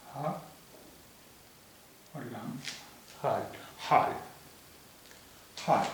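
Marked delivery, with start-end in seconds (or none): 3.2-4.1 हाल with a throaty, breathy Arabic-style ह; the word हाल spoken as a model for students; careful Urdu pronunciation. Shown as a demonstration. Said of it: "आप ये देखिए शब्द ये है".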